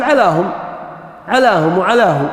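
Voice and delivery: علاهم with a long "aa", as "alaahum"; dialect alif kept. Shown as a demonstration. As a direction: keep the alif sound in علاهم and علاه, no "ay". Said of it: "في بعض اللهجات ما يقلبها".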